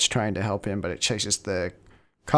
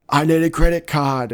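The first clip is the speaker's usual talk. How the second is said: loud, high plea under fire; acted urgent demand, raised and loud; urgent shout; 5 semitones higher, 7 dB louder than usual. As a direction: yell it as if pinned down by gunfire. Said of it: "while they're under fire".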